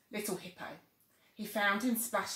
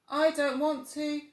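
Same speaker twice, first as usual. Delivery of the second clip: put-on higher, louder drowsy child; yawning little tiger; 8 semitones higher, 8 dB louder than usual. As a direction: fight the yawn. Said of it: "yawned Little Tiger".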